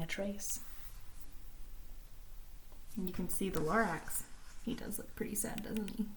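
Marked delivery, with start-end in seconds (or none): 3.0-6.1 soft whispered aside; hush, share it quietly; soft whispered comment. Real, not acted. Aside to listeners.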